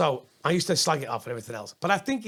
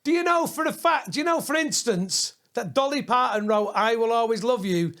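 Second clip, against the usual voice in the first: mock heckle topper; 6 semitones higher than usual. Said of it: "I'd go".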